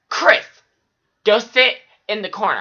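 loud, high sharp order to the corner; teacher scolding. Retold or imitated. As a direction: bark it like an angry teacher. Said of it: "She would say".

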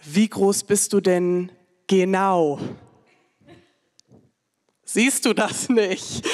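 ordinary talk

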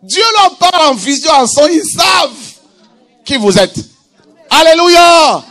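high, loud preaching shout; prédication à pleine force. Real, not acted. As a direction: proclaim it to the whole congregation. (prédication criée, voix haute et puissante)

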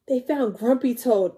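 plain reading voice

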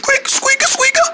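very high squeaky noises; pretend squeaky buttons. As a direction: squeak it out like a pressed toy.

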